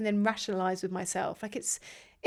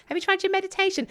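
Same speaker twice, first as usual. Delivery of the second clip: much higher mocking boyfriend voice; 10 semitones higher than usual. Girlfriend imitating boyfriend's meditation advice, high mocking voice. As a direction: send up his smug suggestion, pitched high. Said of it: "I'm not asking you to come to me with solutions".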